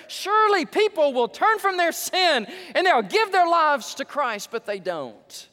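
loud, high, impassioned preacher's cry; preaching at full force. Acted. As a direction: build the plea to a ringing shout. Passionate preaching climax.

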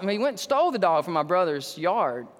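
normal talking voice

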